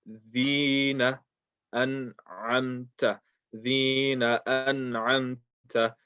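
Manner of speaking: slow, measured "Dhina an'amta", short "na"; noon kept short. Shown as a demonstration. Teacher models practising these two words, noon kept short. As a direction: recite "Dhina an'amta" slowly without stretching the noon. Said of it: "reading this noon and not making it long".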